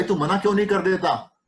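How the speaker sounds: ordinary delivery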